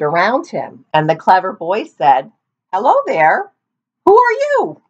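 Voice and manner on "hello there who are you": mock innocent boy. A narrator's reading. Boy pretending not to know.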